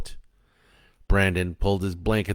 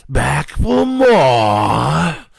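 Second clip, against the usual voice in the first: loud, drawn-out demonic taunt, higher pitched; taunting devil; 6 semitones higher, 10 dB louder, much slower than usual. A narrator's reading. Devil mocks the returning rescuer, taunting. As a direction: jeer like a devil, stretching every word. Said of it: "the devil taunted".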